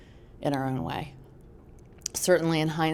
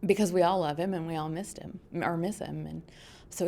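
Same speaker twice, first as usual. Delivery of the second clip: normal talking voice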